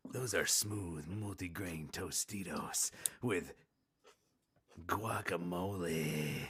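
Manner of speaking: slow, low, soft ASMR voice; ASMR whisper. Pretend. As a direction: draw it out like an ASMR video. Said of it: "if you like A S M R".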